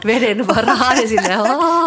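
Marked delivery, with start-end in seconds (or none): 1.4-1.9 mock cry for help. Pretend drowning cry.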